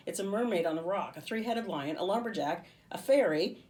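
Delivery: building to a loud high shout; impatient. A narrator's reading. Livy listing cover figures, building to a shout. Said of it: "she said impatiently".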